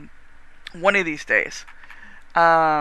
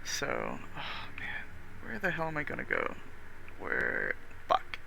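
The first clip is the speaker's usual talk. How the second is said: muttering; 5 semitones lower, 12 dB quieter than usual. Real, not acted. Muttering to self, searching screen.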